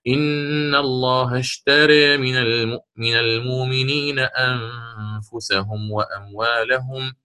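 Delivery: slow, chanted Quranic recitation. Shown as a demonstration. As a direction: draw out each vowel in tajweed chant. Recites the four example verses. (تلاوة مرتلة بطيئة)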